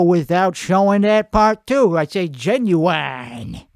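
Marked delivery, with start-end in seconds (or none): 2.3-3.6 slow Southern drawl on "genuine"; drawled-out Southern twang; Southern accent. Shown as a demonstration. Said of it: "I also have a Southern accent".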